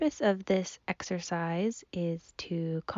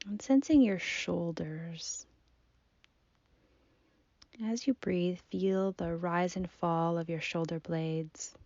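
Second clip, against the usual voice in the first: soft, unhurried meditation voice with long pauses; guided body scan; 5 dB quieter than usual. Acted. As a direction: gently guide the listener's attention through the body. Leads a seated body scan.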